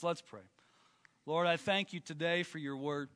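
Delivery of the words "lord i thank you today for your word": prayer. Acted. Leads a prayer.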